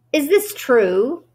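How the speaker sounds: slow, high, suspicious question. A narrator's reading. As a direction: ask it warily. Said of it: "eyeing the sack suspiciously".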